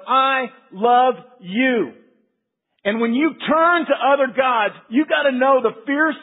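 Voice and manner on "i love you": very slow, louder, heartfelt; tender declaration. Acted. God telling his creation, tender slow declaration. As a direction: lean in and mean it, slowly.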